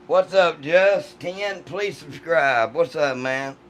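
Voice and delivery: ordinary talk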